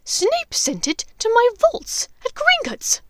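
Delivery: high, loud and slow witch voice; a shrill dark witch demanding answers; menacing witch. Acted. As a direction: loom over him with each word. Bellatrix interrogating.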